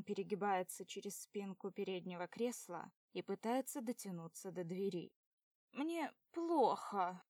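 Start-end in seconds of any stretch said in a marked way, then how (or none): 5.7-7.2 higher, louder, very slow moan; drunk wail. A narrator's reading. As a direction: whine it drunkenly. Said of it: "кричит Соня".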